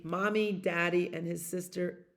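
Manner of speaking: normal talking voice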